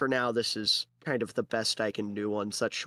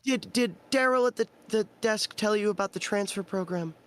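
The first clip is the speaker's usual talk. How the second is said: high, halting female voice; woman clerk; 8 semitones higher than usual. Acted. Clerk asking him about the transfer program, high and halting. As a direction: stammer into the question, pitched up.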